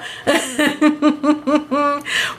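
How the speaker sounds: high, helpless giggling fit; breathless giggle at herself; long laugh. Real, not acted. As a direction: let the laugh roll out. Long laugh after slip.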